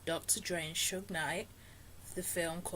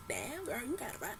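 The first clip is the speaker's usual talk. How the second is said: impressed murmur; 4 semitones higher, 7 dB quieter than usual. Retold or imitated. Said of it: "kinda looking at her like".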